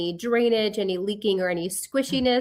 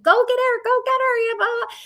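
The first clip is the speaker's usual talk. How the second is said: shrieky high mock yell; imitated yelling; 14 semitones higher, faster than usual. Mother in her nightmare ordering husband to save daughter, imitated yelling. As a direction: pitch it way up, frantic but mock. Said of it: "I'm yelling at my husband".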